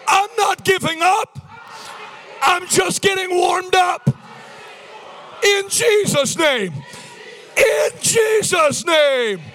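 high, loud, drawn-out preacher shouts; forceful declaration. Real, not acted. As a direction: proclaim each phrase slowly at full force. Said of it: "Say it with force".

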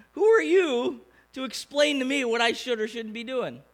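hammed-up indignant comeback; mock indignation. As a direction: act out an offended leader snapping back. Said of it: "Moses probably could have lost it".

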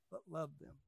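sudden soft drop after the big build; hushed. Acted. Preacher turning softly from judgment to love, hushed. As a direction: let it fall to a hush.

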